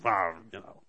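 low, grumbling doubter's voice; low disgruntled grumble; grumbling murmur. Retold or imitated. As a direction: mutter it like a sulky doubter. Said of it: "this means".

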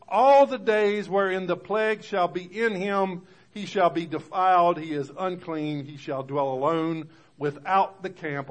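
loud, high reading, slow at first; preaching scripture. Acted. Preacher reads the law of the leper to the congregation, loud. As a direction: cry out the verse like a proclamation.

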